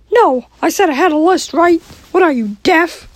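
Mickey Mouse voice. Acted. Mickey snaps at host.

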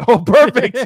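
loud, high burst through laughter; real laughing outburst; delighted laughing. Real, not acted. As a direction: exclaim it while cracking up. Cracking up at camera mishap.

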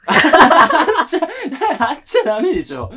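high, loud protest through laughter; cracking up while insisting; laughing protest. Real, not acted. Laughing at his friend's answer, a high loud protest.

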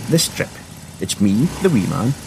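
ordinary delivery, nothing marked

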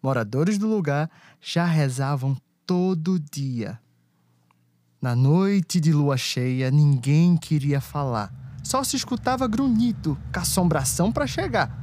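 rhythmic rhyming verse storytelling; cordel recitation. A narrator's reading. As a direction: recite like a folk poet.

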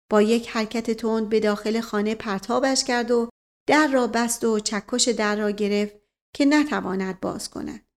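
ordinary delivery

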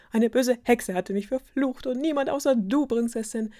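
high, loud excited explaining; enthusiastic prince. A narrator's reading. Prince tells the princess of his curse, enthusiastically. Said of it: "rief der Prinz begeistert".

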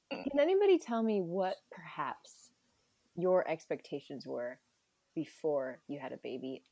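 whispering. Acted. Whispered host question.

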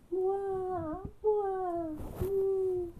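put-on high wailing baby cries; acted baby wail. Baby elephant crying in the night, high mock wailing.

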